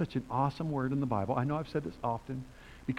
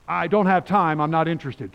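louder, higher imitated brush-off; mock brusque refusal; 4 semitones higher, 8 dB louder than usual. Householder to preacher knocking on doors, mock brusque refusal. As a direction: send the door-knocker away loudly.

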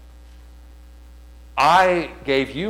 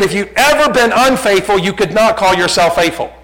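high, loud reasoning; forceful retold argument; 8 semitones higher, 9 dB louder than usual. Preacher arguing with the young man in retelling, loud and forceful. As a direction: drive the point home at full volume.

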